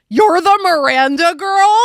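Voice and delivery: high, loud and drawn-out exclamation; slow, loud, high-pitched amazement; excited recognition. Retold or imitated. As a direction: blurt it out, imitating a thrilled fan. Retold excited recognition of speaker.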